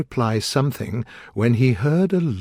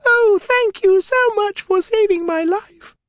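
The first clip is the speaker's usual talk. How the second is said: tiny squeaky mouse, very high; high, tiny and thankful; little mouse voice; 4 dB louder than usual. A narrator's reading. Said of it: "in a little voice".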